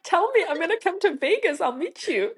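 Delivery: thrilled at the idea; excited. Real, not acted. Excited about visiting Vegas.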